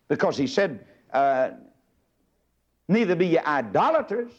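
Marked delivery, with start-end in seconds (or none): 2.9-4.3 preach. Acted. Reading scripture in sermon cadence.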